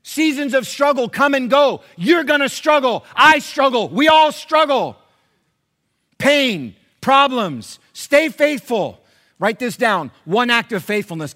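loud, high, driving sermon delivery; high-energy preacher at full volume; full-force preaching. Real, not acted. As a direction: preach it out to the whole room, punching each phrase.

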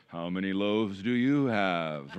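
low, slow, droning mock repeat of Jesus' question; teasing, drawn-out "how many loaves do you have"; weary mock disciple. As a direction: mimic a catchphrase the way kids tease dad. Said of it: "they would go".

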